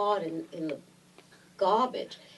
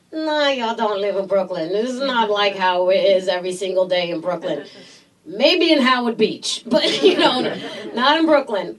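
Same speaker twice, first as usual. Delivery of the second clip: loud, animated retold argument; emphatic rebuttal; 7 dB louder than usual. Brooklyn local correcting fellow actors on set, loudly emphatic. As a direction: push back hard, laughing towards the end.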